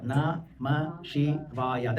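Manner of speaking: very slow, each syllable separated. Shown as a demonstration. Teacher giving the Panchakshari mantra, syllable by syllable. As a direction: draw out every syllable of the mantra.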